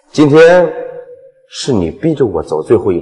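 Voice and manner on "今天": loud threat. Acted.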